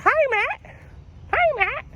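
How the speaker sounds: very high falsetto squeak; caseworker's chirpy greeting. Retold or imitated. Caseworker greeting Matt on the phone, squeaky imitation. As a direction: imitate her in a tiny squeaky voice. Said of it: "That's how she talks".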